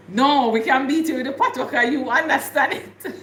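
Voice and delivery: loud, laughing teasing reply; laughing retort. Retold or imitated. Teasing a friend who understands patois, laughing loudly. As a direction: laugh the words out high and loud.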